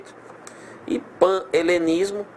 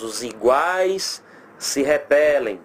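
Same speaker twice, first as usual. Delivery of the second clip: very slow, stretched-out delivery; slow emphatic rule; much slower than usual. Shown as a demonstration. Teacher giving pupils the logic to remember, slow and emphatic. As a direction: drag each word out like a maxim to memorise.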